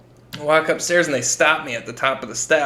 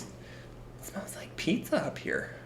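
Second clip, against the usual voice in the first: whispered, slow mother's worry; a mock-whispered suspicious mom; whispering mom smells pizza; hushed mom; 4 semitones higher, 15 dB quieter, slower than usual.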